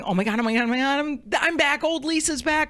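high-pitched mock freak-out; retold panic. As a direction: spiral upward into comic self-reproach. Acting out her shame spiral panic.

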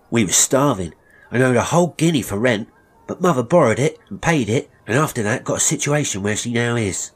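put-on high, loud, urgent young Tobias; earnest apprentice boy. Boy defending his starving mother to master, urgent.

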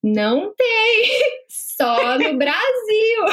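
drawn out word by word, laughing at the end; very slow. Retold or imitated. Customer insisting to bank clerk the dress is only from Brazil, very slow. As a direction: spell it out very slowly, breaking into laughter. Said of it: "comecei a falar bem devagar".

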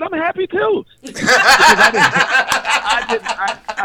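loud laughing through the words. Real, not acted. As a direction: crack up as you say it. Laughs at his own misunderstanding.

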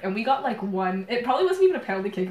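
ordinary talk

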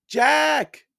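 a high, loud call of his name from above. A narrator's reading. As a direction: shout down into the dark for your brother. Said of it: "Annie was calling from above".

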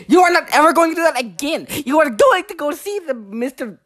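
high, rapid scolding; mad teacher. Retold or imitated. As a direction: imitate an enraged teacher telling a kid off. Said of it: "She got so mad".

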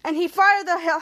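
usual speaking voice

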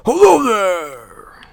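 high, slow, loud showy hello; booming TV host greeting. Acted. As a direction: greet the camera like a game show host. Mock on-air greeting on camera.

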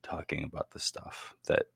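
hushed conspiratorial whisper. Real, not acted. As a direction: drop to a secretive whisper. Hinting at forbidden stuff.

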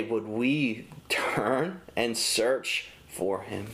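normal talking voice

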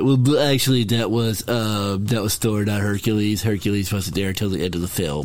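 lightly voiced pedantic fan correcting a mistake; a hint of a know-it-all listener; nitpicking viewer. Cohost mocking people at home who correct them, pedantic. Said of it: "people at home like".

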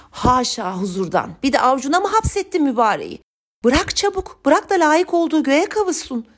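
high-pitched, indignant scolding; old woman's outraged outburst, building in pitch; angry flare-up. A narrator's reading. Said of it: "diye parladı".

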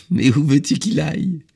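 put-on high, loud child-like question after a laugh; laugh, then ask in amused disbelief; amused little boy, pitched well up; bursting with laughter. A narrator's reading. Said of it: "eut un nouvel éclat de rire".

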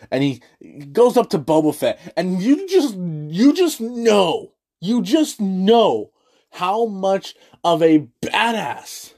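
ordinary talk

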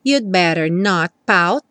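slow, "not pout" with T unreleased. Shown as a demonstration. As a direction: close "not" without releasing the T. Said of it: "aqui duas vezes". (T de "not" sem soltar)

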